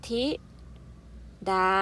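ธิ ... ดา split into slow separate syllables; spelling out the word for kids. Shown as a demonstration. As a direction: read ธิ and ดา apart, slowly. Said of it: "อ่านว่า".

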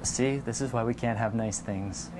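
low, soft mock accusation; hushed, playful scolding; teasing example. Shown as a demonstration. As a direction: tease softly, dropping to a whispered finish. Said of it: "here's a few other examples".

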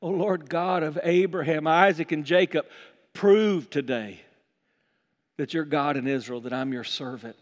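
low, measured plea to God; solemn prayer. Acted. Elijah at the altar praying for proof, low solemn prayer. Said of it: "he prayed".

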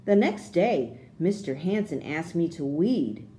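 ordinary delivery

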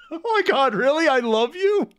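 high-pitched disbelieving exclamation; incredulous. Real, not acted. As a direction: squeal in amused disbelief. Stunned reaction to character's confession.

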